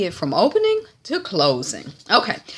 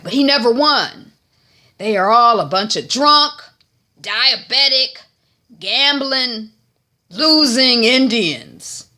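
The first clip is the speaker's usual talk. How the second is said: loud, high, punched word by word; contemptuous rant; 7 semitones higher, 8 dB louder than usual. Retold or imitated. Old man dismissing his rival, contemptuous rant. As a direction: hammer out each insult with a pause between.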